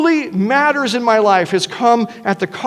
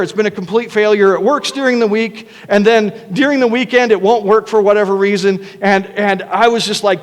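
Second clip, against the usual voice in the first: ordinary talk, nothing marked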